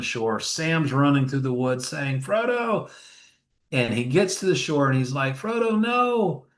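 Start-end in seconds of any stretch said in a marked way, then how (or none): 2.2-2.9 mock call through the woods. Retelling Sam calling through the woods. 5.3-6.4 high, long-drawn cry at normal volume; slow, high mock call; pleading call. Retelling Sam calling from the shore.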